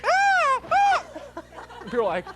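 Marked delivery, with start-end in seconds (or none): 0.0-1.0 put-on high, loud toddler shriek. Doing the kid scream voice out of the blue. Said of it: "I love doing that voice".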